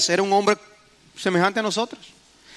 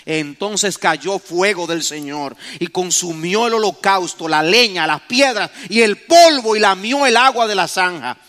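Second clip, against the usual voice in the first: ordinary talk, nothing marked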